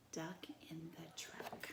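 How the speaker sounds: breathy hushed whisper of the title; soft whispered title. Shown as a demonstration. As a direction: whisper it like a secret.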